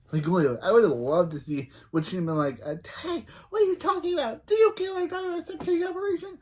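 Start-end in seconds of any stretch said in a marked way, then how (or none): 3.0-6.4 high squeaky put-on voice; mock outraged reaction. Mock shocked reaction, acted.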